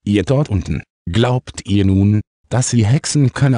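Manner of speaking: ordinary delivery, nothing marked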